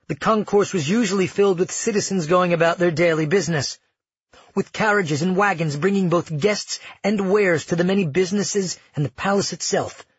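usual speaking voice

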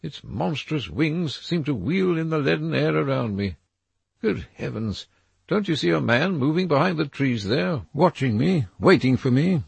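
put-on a nervous young man seeing a threat in the trees; frightened Dorian. A narrator's reading.

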